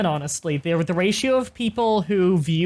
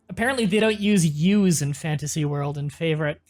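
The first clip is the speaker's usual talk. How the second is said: usual speaking voice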